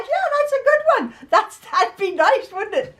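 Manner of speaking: laughing reply. Retold or imitated. Answering her husband's joke, high and laughing. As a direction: play along with the joke through laughter. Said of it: "I'm like".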